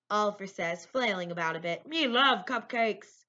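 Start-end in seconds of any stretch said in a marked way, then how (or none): 1.9-3.2 slow, loud gobbling monster voice; big, higher-pitched cartoon monster; Cookie Monster. A narrator's reading. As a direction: drag the words out like a besotted puppet monster. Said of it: "That's Cookie Monster who talks like that".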